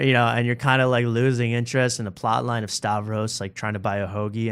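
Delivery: ordinary talk, nothing marked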